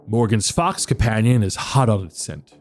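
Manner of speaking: ordinary talk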